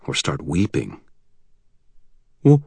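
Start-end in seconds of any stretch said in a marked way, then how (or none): 2.4-2.6 stammering. Acted. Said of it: "I stammered".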